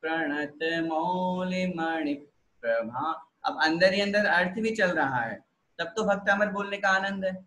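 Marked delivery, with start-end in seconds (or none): 0.0-3.3 slow, unhurried chanting; calm, slow devotional recital; slow recitation. Shown as a demonstration. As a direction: savour each word of the hymn. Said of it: "Bhaktamar अपन बोल रहे हैं तो आराम से".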